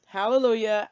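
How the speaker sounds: loud, high hallelujah of relief; relieved cheer. Real, not acted. Telling viewers help is coming, a relieved loud cheer. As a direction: celebrate the help like a win.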